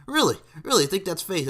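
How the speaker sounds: mock-incredulous challenge, jumping high and loud; Doctor D. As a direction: act out a wrestler challenging the doubter. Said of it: "and Doctor D goes".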